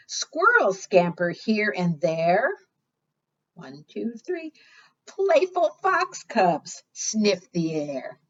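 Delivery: sing-song picture book reading; reading to children. Acted. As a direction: read the rhyme out to the little ones. Reads rhyming picture book aloud.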